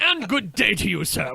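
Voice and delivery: laughing, loud mock-English farewell; mock English accent. Polite English brush-off, in mock accent. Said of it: "you gotta pull that accent out".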